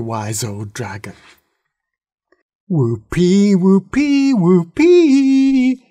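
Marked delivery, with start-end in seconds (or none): 2.7-5.8 rising high, loud whoops; young dragons cheer for thunder, rising to loud high whoops; cheering whoopee. A narrator's reading. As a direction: cheer louder and higher each time. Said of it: "said the young dragons".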